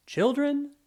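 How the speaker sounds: high, loud call to the class; calling out to children. Acted. Officer calling the children to listen, loud and high. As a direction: grab the children's attention.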